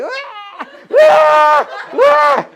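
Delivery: high, loud mock bawling; repeated screechy cries of a put-on meltdown; mimicked tantrum wail. Kid having a breakdown, exaggerated crying. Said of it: "your kid is having a break".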